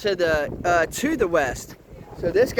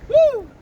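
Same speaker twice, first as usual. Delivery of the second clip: effort grunt; 10 dB louder than usual. Real, not acted. Lifting the rotten tree.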